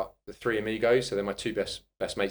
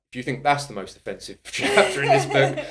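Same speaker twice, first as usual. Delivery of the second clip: laughing retort; 5 semitones higher, 8 dB louder than usual. Retold or imitated. Retelling his reply to editors.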